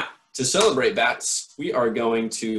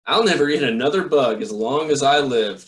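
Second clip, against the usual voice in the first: louder, happy cheer with a full belly; 3 semitones higher, 7 dB louder than usual. Acted. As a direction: cheer it out, stuffed and delighted. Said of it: "cheered Stellaluna".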